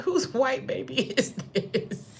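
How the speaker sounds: slow quote broken by laughter; imitating mom while cracking up; laughing mock mom. Retelling mom's question about the pale newborn, laughing through it. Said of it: "she said".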